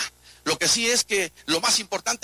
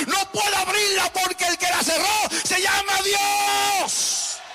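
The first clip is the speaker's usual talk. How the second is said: Noah near tears, helpless; almost crying; 12 semitones higher, slower than usual. Retold or imitated. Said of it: "Noé decía casi llorando". (casi llorando, agudo y lento)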